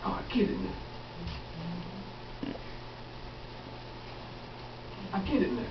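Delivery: soft, almost breathed dawning understanding; quiet realization. Acted. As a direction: murmur it as it sinks in.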